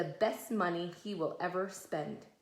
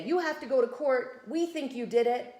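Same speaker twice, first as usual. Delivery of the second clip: mock authority; 4 semitones higher, 5 dB louder than usual. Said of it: "And they say".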